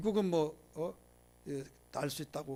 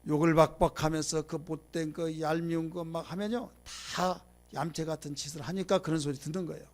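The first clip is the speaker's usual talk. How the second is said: normal talking voice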